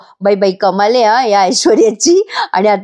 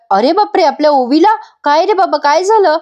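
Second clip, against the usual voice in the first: higher, anxious alarm; alarmed surprise; 7 semitones higher than usual. Acted. Grandmother hears her granddaughter was turned away, alarmed. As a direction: gasp it out in a worried rush.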